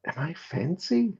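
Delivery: high, slow, wondering question; puzzled self-doubt. Acted. Lord looks back at his companion, puzzled. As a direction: draw it out high, unsure of yourself.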